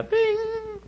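ping it like a bell of light; bright bing. Pretend. A preacher marking the hopeful verse with a high, slow bing. Imitating a light breaking through.

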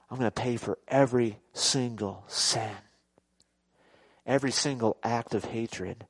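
low, soft, deliberate vow; Jesus's solemn promise. Acted. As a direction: promise it quietly, pausing between phrases. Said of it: "Jesus came and says".

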